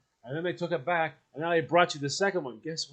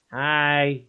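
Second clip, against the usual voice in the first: nosy neighbour; 6 semitones higher, 3 dB louder, slower than usual. Retold or imitated. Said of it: "they're gonna find you sitting".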